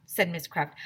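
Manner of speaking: usual speaking voice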